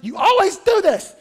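loud, high angry outburst; Martha going off. Acted. Martha snapping at Mary in front of Jesus, furious rant. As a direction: snap and rant at your sister. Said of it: "she just goes off on Mary".

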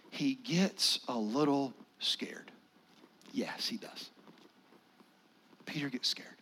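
hushed whisper about the fear. Shown as a demonstration. Pastor to congregation, whispering softly. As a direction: drop to a breathy whisper.

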